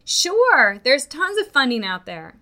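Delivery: high, loud breezy reassurance; upbeat businesses. Retold or imitated. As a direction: brush it off like an eager salesman. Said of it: "The businesses say".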